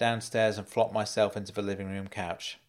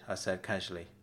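plain reading voice

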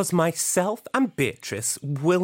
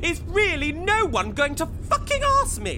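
exasperated; 11 semitones higher than usual. Acted. Frustrated no one asks.

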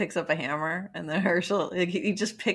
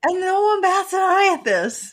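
high, loud incredulous exclamation; mock outrage; 11 semitones higher, 7 dB louder than usual. Real, not acted. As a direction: call it out in loud disbelief.

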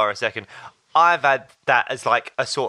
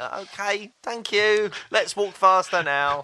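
awkward laughing thanks; 7 semitones higher, slower than usual. Retold or imitated. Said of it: "And I was like".

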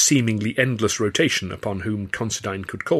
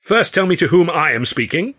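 loud, higher insistent demand; 8 semitones higher, 6 dB louder than usual. A narrator's reading. Ed refuses to back down to the stranger, loud demand. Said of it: "Ed demanded".

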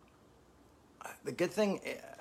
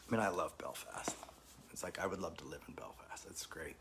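whispering; 4 semitones lower, 9 dB quieter than usual. Real, not acted. Confiding aside about Belfast.

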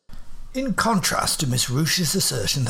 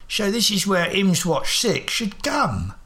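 slow Cockney voice dropping the h in health, halting; chagrined Cockney; 7 semitones higher, slower than usual. Acted. Holmes confused by the guard, chagrined Cockney. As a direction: stammer like a put-out Cockney.

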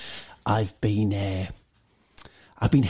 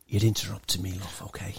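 forced politeness; 5 semitones lower, 4 dB quieter than usual. Acted. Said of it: "No, I'm trying to be nice".

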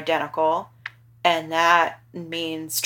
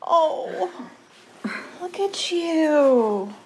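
tender and slow; 11 semitones higher, much slower than usual. Real, not acted. Mom admiring her son.

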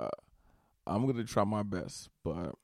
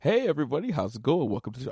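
host greeting; 5 semitones higher, 6 dB louder, much faster than usual. Retold or imitated. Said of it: "go to the next show".

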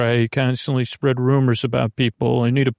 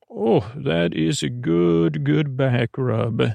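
slow, drawn-out enjoyment; blissful centaur being massaged; back-rub bliss; slower than usual. Acted. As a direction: savor each word under a massage.

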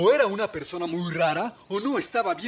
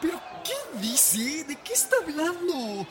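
baffled villager; 6 semitones higher than usual. Acted. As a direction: sound completely confused. Villager confused, voiced.

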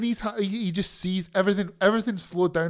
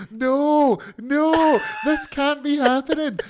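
rising, louder panicked pleading, a catch in the voice; mock horror; 6 semitones higher, 3 dB louder, slower than usual. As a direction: play the dread of watching a disaster. Acting out horrified reaction.

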